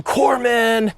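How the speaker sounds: a high, half-acted cry for the corpsman; retold scream. Wounded Marine calling for a medic, a mild mock scream. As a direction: call for the corpsman through the chaos, mildly. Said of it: "like, screaming".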